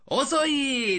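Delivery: high, drawn-out teasing complaint; not really angry. Acted. To a friend arriving late, mock reproach. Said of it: "声のトーンで全然怒ってない".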